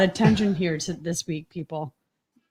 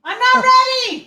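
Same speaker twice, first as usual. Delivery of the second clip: high, slow panicked wail; 14 semitones higher, 6 dB louder, slower than usual. Real, not acted. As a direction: wail that you're not ready. Panicked start of live stream.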